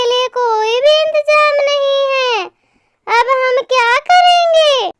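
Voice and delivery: high-pitched little chick whining to mummy; complaining baby bird. Acted. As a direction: play a young bird troubled by heat, pitched well up. (परेशान नन्हे पक्षी की ऊँची आवाज़)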